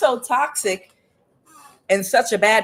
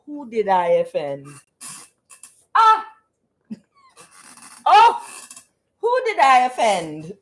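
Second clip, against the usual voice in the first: indignant ancestor; 4 semitones higher than usual. Retold or imitated. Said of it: "The ancestor says".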